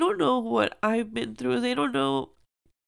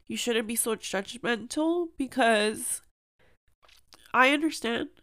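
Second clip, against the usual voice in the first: ordinary delivery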